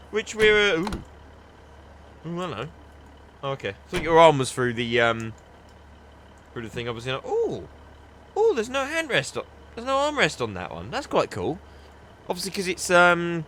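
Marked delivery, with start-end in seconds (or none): none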